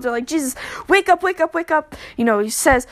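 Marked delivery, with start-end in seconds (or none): 0.3-1.9 higher, urgent pleading to wake him; lightly voiced panicked disciple shaking him awake. Disciples begging sleeping Jesus to wake, mock panic. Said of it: "they're freaking out".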